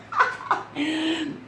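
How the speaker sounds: high, tickled chuckle; real laugh. Real, not acted. Mom recalls catching her kids out, laughing. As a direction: chuckle at the memory. Laughs at own retold exchange.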